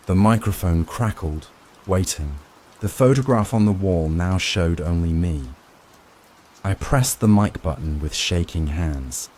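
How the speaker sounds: ordinary delivery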